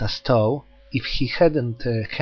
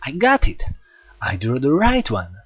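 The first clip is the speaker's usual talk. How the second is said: joyful exclaim. Acted. Hyoma crowing over his summoning, excited. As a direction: cry out your lucky win. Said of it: "face shone with joy".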